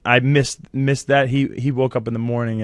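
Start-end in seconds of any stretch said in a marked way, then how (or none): 0.0-1.3 louder and higher, voice breaking with tears; pushing through a sob; choked up. Real, not acted. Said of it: "and I still cried".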